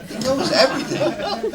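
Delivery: high and loud through laughter; laughing. Real, not acted. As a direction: laugh it out in disbelief. Laughing retelling.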